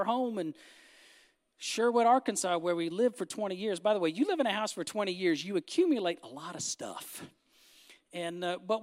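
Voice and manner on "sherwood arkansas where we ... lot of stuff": ordinary delivery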